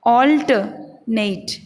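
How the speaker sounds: Alternate slowly as "AWL-tuh... NAYT"; slow two-part demo; correct, broken up. Shown as a demonstration. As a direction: sound out alternate with a gap before NAYT. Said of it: "इसका उच्चारण होगा".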